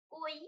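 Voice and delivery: "কই" with little air on the k; unaspirated k. Shown as a demonstration. Teacher models "কই" for the class, little air. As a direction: keep "কই" light with no puff. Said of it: "অল্প বাতাস বের হচ্ছে".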